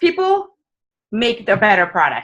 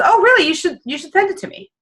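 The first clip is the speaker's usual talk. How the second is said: nosy uncle; 9 semitones higher than usual. Retold or imitated. Nosy uncle at a Christmas party asking to see the plan. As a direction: play the prying uncle, bright and keen.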